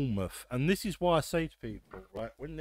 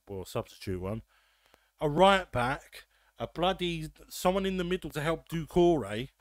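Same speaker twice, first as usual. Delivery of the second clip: ordinary talk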